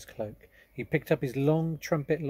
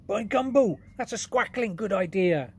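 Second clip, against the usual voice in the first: much higher, louder giant exclaiming; delighted giant; 9 semitones higher, 8 dB louder than usual. Acted. BFG delighted by idea.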